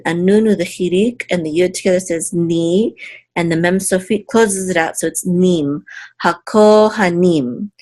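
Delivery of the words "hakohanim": Hakohanim drawn out slowly, ko held long; stress on Hakohanim syllables. Shown as a demonstration. Teacher sounding out the Hebrew word, Hakohanim slow with ko held. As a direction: stretch the ko in Hakohanim, lean on nim. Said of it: "This is a secondary accent mark".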